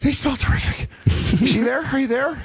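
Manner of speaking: very high, shouted, gushing ex; mock girlfriend shout. Playing the ex calling back, very high squeaky shout. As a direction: squeal out the love lines. Acted bit continues.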